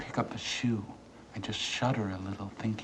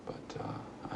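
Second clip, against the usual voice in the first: whisper; 9 dB quieter than usual. Acted. Hesitant aside before speaking.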